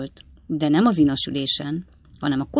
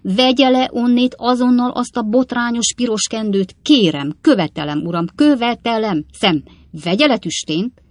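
loud, higher commanding scold; angry demand; 6 semitones higher, 5 dB louder than usual. A narrator's reading. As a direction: insist furiously, no arguing. Pickwick demands flag removed. (dühös követelés)

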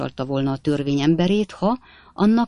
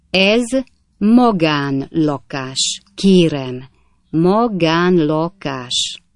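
each word pressed, slow and louder; every word pressed; 4 dB louder, much slower than usual. A narrator's reading. Snodgrass to the officer, each word pressed slow. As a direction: lean hard on every word, slowly. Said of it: "minden szót megnyomva így szólt".

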